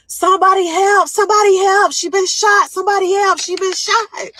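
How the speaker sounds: high, loud panicked cry for help; desperate screaming for help. Retold or imitated. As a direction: yell across the hospital for someone to come. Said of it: "carried me inside ... screaming".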